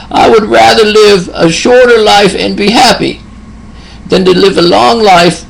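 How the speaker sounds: high, lightly put-on old man's voice; elderly patient. Old patient quoted by nurse.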